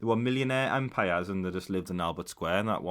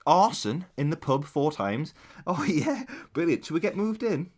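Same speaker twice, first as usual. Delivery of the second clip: higher, slow and jolly, oblivious; mock cheerful buyers; 6 semitones higher than usual. Househunters checking Albert Square crime, mock cheerful voice. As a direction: read the crime stats like happy househunters. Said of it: "imagine you did one".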